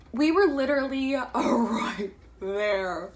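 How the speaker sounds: half-laughing through the words; a laugh breaking into the line; laughing while talking. Real, not acted. To her viewers, laughing at how close she got.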